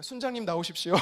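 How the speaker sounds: deferential, toned-down summons; polite request. Acted. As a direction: ask the leader out politely, amused. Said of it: "제가 어떻게 이럴 수 있겠습니까?".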